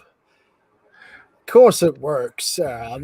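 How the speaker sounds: slow, hammy Aussie drawl; jokey fake Australian accent; mock Australian accent. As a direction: put on a bad Australian accent. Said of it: "This is my really authentic Australian accent".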